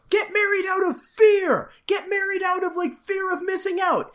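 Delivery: high-pitched, urgent mock urging; frantic urging. The episode urging everyone to marry, high frantic mock urging. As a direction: hype up the bad idea, wide-eyed.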